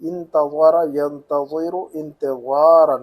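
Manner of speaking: slow, careful انتظر ینتظر انتظارا with ظ from the tongue tip; emphatic Arabic ظ. Shown as a demonstration. Teacher drills the Arabic letter, slow emphatic ظ in انتظر ینتظر انتظارا. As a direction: draw out each heavy ظ in انتظر ینتظر انتظارا. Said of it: "زبان کے نوک سے نکالنا پڑتا ہے".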